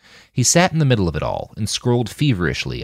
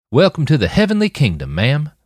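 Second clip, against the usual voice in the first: put-on young guard's drawl, voice raised; 7 semitones higher, 4 dB louder than usual. A narrator's reading. Young guard greets newcomer Sasha with a drawl. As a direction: drawl it out, friendly and country. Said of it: "the boy drawled".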